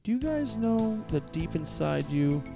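usual speaking voice

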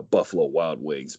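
ordinary talk

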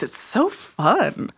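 ordinary delivery

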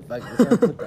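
riendo a carcajadas. Real, not acted. Joke punchline, group laughing.

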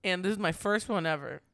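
ordinary delivery

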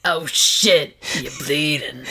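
wheezy low mob wise-guy voice; mobster with a tracheotomy. Acted. [name] as a wheezing mob wise guy, shocked at the blood. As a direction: rasp it like a throat-damaged gangster. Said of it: "Frankie the mobster with a tracheotomy".

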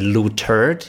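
"loo turd" in two slow parts, weight on "turd"; emphasis on TURD. Retold or imitated. Complaining how people say his surname, "turd" stressed in "loo turd". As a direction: land hard on "turd" after a clear "loo". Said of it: "with an emphasis on turd".

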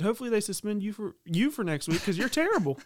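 mock taunt. Player retorting to the umpire, teasing and quick. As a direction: toss the insult back lightly. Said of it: "I said".

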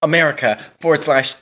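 high, loud advert-style plug; mock ad read. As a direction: read the URL like a hammy advert. Mock ad reading web address.

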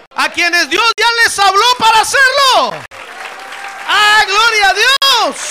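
high, loud, slow climb into a shout; thundering revival shout; preaching at full force. Acted. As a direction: rouse the congregation, building up.

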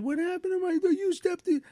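put-on high, whiny kid voice; little daughter's voice. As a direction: play his upset little girl. Daughter's voice in a story.

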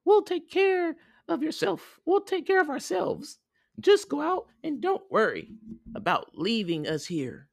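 put-on very high squeaky kid voice; high cartoon child voice; little goat kids. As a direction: reassure mother in a tiny squeal. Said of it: "the kid said".

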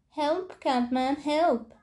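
high, slow, drawn-out cry for help; kinderen gillen om hulp. Acted. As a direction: call out like a frightened child far off. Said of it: "te gillen". (hoog en langgerekt hulpgeroep)

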